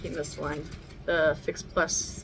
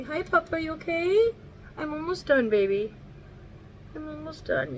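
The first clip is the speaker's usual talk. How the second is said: higher, sing-song pet voice; 10 semitones higher than usual. Real, not acted. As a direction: coo at the pup beside you. Talking sweetly to her dog.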